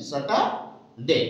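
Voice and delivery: Saturday said very slowly with no r; silent r, slow. Shown as a demonstration. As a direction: model Saturday slowly, r dropped. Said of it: "R also here is silent". (r ছাড়া ধীরে Saturday)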